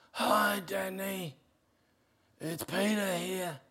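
a sick man straining to speak; weak, barely talking. Retold or imitated. Dying friend phoning the pastor, speaking softly and weakly. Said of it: "Can hardly talk".

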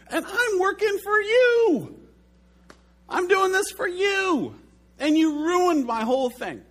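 high, loud, furious plea at the sky; enraged at God. Retold or imitated. Pastor reliving yelling at God over rained-out carnival, enraged. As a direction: rail at God like he betrayed you. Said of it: "I was enraged at God".

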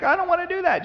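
very high mock whine; whiny refusal. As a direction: refuse like a reluctant kid. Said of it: "He's like".

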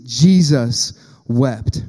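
very slow and loud, each word drawn out; solemn stress. Acted. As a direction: let "Jesus wept." hang, slow and heavy. Climax of the scripture reading.

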